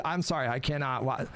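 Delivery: ordinary talk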